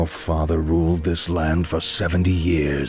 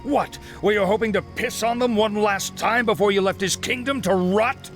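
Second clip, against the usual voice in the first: high, fast and bitter; furious taunt; faster than usual. Acted. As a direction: spit the insult at him. Uther taunting Arthas angrily.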